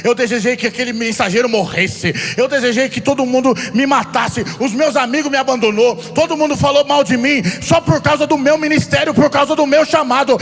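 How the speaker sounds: preaching at full force. Acted. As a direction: preach it with fire to the congregation. Preaching builds to shouting.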